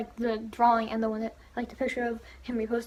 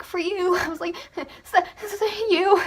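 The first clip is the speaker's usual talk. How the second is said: nervous stammer; 8 semitones higher, 6 dB louder, slower than usual. Retold or imitated. Starstruck fan stammering gift.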